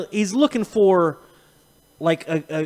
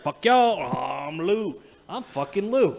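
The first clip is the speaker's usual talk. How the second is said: brash movie line; 5 dB quieter, slower than usual. Retold or imitated. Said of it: "Jumped up in the chair like".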